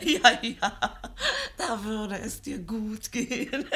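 high, laughing words, slowing at the end; laughing teasing. Acted. Wife teases her old husband through laughter, high and amused.

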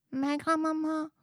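put-on high, soft, timid child voice; little girl voice. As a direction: ask it small and wondering.

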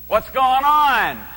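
loud, high, drawn-out call. Acted. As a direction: call out the question over a passing crowd. Acted blind beggar calls out.

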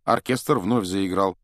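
ordinary delivery

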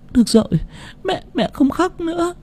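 high, very soft tearful voice; soothing child. A narrator's reading. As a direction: hush the little one softly through tears. Mother soothes her child. (mẹ dỗ đứa con nhỏ, giọng cao, rất khẽ)

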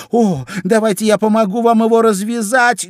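high, loud, eager offer; eager to help. A narrator's reading. Alice offers to untie the knot, eagerly. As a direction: burst out with a high-pitched offer. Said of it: "всегда готовая оказать услугу".

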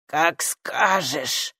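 low, very slow creature grumble; grumbling. A narrator's reading. Stranger gives in to the girl, grumbling. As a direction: drag it out grudgingly. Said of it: "проворчали ей в ответ".

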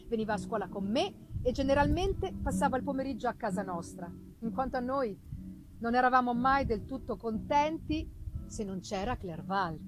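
usual speaking voice

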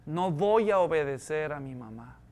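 low, quiet first-person thought; hushed, low inner voice of defiance; devil as inner thought. Acted. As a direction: drop it low and soft, like a planted thought. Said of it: "Lo que el diablo habla es esto".

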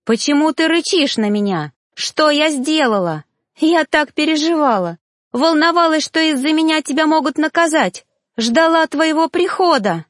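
high, hurt, rising to near tears; upset pleading. Acted. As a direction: plead with him, wounded and high. Sia hurt, pleading with him.

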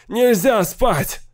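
very high, drawn-out cry; slow, high panicked wail; frantic yell. A narrator's reading. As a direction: beg them not to let you sleep. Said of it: "вопил Сейл".

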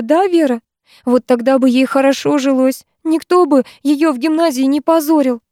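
put-on high, tearful teenage girl voice; a sobbing girl pouring out her hurt; distraught girl. A narrator's reading. Lyusya tells Vera she hates her mother, distraught and high.

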